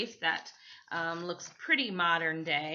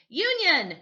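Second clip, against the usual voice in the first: much higher, louder cry; eager shout; 10 semitones higher, 9 dB louder than usual. Acted. Michael shouting the answer to Mister Portafoy. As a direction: blurt the answer like a keen pupil. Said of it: "Michael shouted out".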